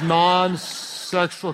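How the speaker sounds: stretched-out, deliberate explanation; really slow. Shown as a demonstration. Pastor to still-confused listeners, very slowly. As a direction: draw each word out for the slow learners. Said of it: "I'll say it really slowly".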